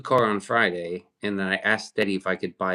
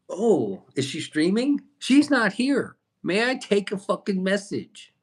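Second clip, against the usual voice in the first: mock secretary; 10 semitones higher than usual. Mocking viewers asking about Steady.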